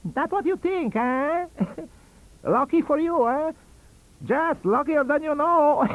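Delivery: very high, thin puppet squeak, cackling; Punch-like voice. A narrator's reading. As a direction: sound like Punch in a puppet show. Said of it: "In a thin, high voice, very much like Punch".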